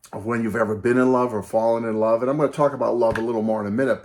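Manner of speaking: usual speaking voice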